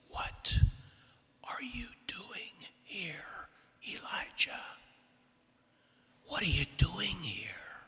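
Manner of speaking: soft, slow whisper; God's gentle whisper. Acted. God whispering to Elijah in the cave, a soft breeze. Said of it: "a very soft sound, like a wind, gentle breeze".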